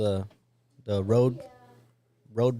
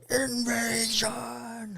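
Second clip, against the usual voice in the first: slow, high, drawn-out proclamation; dramatic announcement; 12 semitones higher, much slower than usual. Shown as a demonstration. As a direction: stretch out invasion grandly. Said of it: "for the invasion".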